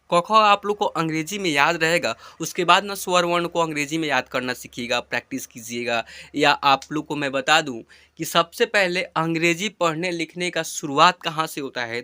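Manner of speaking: ordinary talk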